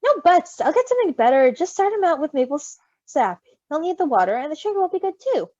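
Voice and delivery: firm and loud, no arguing; insistent, loud. A narrator's reading. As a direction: insist like a caring parent. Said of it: "Patton insisted".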